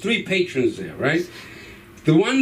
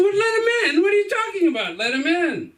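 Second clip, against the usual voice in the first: high, disbelieving outburst; can't believe what dad just said; shocked kid; 12 semitones higher than usual. Retold or imitated. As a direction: kid stunned that dad will let the cops in. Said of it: "I said".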